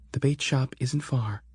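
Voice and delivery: Sarah reassuring. Acted. Sarah gives directions.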